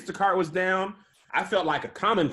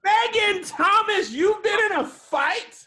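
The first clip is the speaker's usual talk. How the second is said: high, slow, shouted disbelief; stunned cry of her full name; shocked disbelief; slower than usual. Real, not acted. Shocked friend hears fight news.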